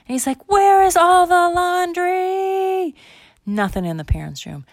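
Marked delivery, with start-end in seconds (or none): 0.5-3.0 put-on high, very drawn-out kid wail; hammed-up loud lament; desperate yell. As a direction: holler it in total despair, stretching the words. Bar desperate, searching.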